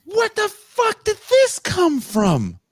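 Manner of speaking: slow, very high incredulous cry; shocked disbelief. Retold or imitated. Telling a friend about the movie's wild twist, aghast. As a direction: draw it out in hammed-up outrage.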